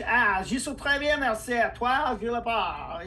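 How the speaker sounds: lilting spoken French; French accent. Shown as a demonstration. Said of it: "like the French".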